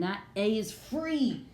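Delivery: triumphant stretch on "free"; emphatic FREE. Shown as a demonstration. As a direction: let FREE ring out long. Teacher celebrates freed vowel.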